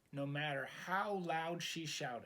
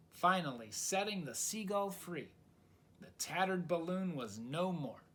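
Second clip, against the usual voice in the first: usual speaking voice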